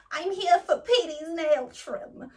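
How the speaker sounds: drawn-out timid request at the counter; nervous dog owner. Retold or imitated. Anxious owner tells the florist she's here, slow and high. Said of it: "She says".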